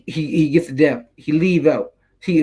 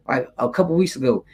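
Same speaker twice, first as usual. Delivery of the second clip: normal talking voice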